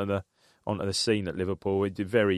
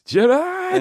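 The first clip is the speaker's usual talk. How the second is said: much higher, louder mock commentator shout of the name; commentator yell; 6 dB louder than usual. Co-host imitating the pundit's excited goal call, mock shout. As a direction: belt out the player's name like a TV pundit. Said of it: "He also says".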